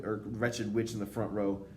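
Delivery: ordinary delivery, nothing marked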